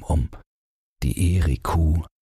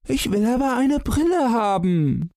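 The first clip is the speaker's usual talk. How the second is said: put-on very high little boy's wail; whining child. A narrator's reading. Son wants glasses, whining high child's voice. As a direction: whine back in a small child's voice. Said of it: "jammerte der Sohn dagegen".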